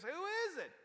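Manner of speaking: sharp, high, angry question; a retold king demanding the name in anger; furious demand. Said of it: "the king gets furious".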